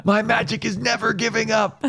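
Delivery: high, earnest anime hero cry; high heroic declaration. Retold or imitated. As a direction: proclaim it like a shonen protagonist.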